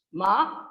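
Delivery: MA gliding upward in pitch; high rising tone. Shown as a demonstration. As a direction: lift MA from mid to high. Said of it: "high rising pitch".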